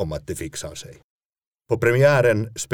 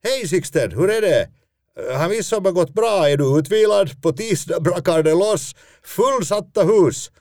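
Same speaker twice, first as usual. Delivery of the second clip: much higher, bright chirpy producer voice on the phone; bubbly producer; 10 semitones higher, 4 dB louder than usual. Retold or imitated. Producer cheerfully greets the injured actor, high eager voice. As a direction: gush at him, slowing down on fullsatta hus. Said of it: "då hon svarar".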